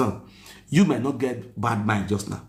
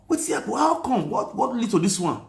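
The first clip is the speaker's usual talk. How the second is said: shocked disbelief; 10 semitones higher than usual. Retold or imitated. Shocked on seeing injured face.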